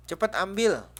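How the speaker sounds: loud, high-pitched sharp order; angry command. Shown as a demonstration. Teacher demonstrating an exclamation mark to students, angry command. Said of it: "Kalau tanda seru artinya marah".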